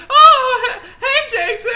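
very high, loud surprised hey; mock startled greeting. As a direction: act caught off guard. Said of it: "I was like".

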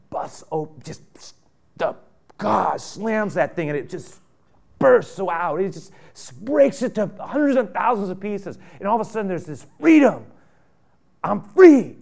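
loud, high triumphant cry; preaching at full force. Acted. Pastor describing God breaking the rock of anger, in a loud triumphant preach. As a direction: burst out with the joy of release.

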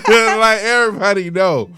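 loud laugh while talking; cracking up mid-sentence. Real, not acted. Cracking up at the pickup line.

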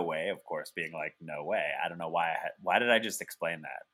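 low, rambling stoned apology. Real, not acted. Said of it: "My edible's kicking in".

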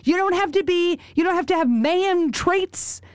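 high-pitched, exasperated objection; indignant protest. Real, not acted. Host objecting to gender inequality, high and indignant.

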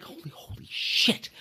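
breathless whispered holy shit; stunned awe. Retold or imitated. Realizing he just met Doug Peterson, whispered awe. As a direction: gasp it out under the breath. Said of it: "I'm like".

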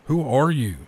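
usual speaking voice

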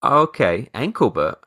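lightly voiced four-year-old; accepting child. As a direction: imitate a child taking it in, voice lifted. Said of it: "and my son goes".

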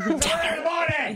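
calling out. Pretend. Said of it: "Who are you on the phone with?".

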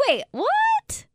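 a high, baffled "wait, what?"; confused disbelief. Retold or imitated. Reacting to a baffling finale, high confused squeak.

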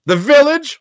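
loud, high-pitched shout-out; calling out. Real, not acted. Host welcoming a chatter called Village, high loud call. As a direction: belt out the name in greeting.

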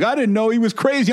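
normal talking voice

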